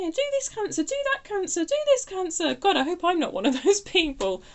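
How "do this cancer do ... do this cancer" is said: high, bossy mock nagging. Reader mimicking pushy people telling Cancer what to do, mock nagging. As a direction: imitate people bossing Cancer around.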